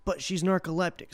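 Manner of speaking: ordinary talk, nothing marked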